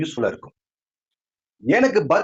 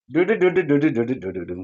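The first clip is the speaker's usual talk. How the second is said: low rhythmic "du-du" drumroll; mouthed wedding music; 4 semitones lower than usual. Acted. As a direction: imitate wedding drums with the voice. Mimics wedding drums as thali tied.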